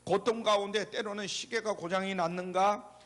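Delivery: ordinary talk, nothing marked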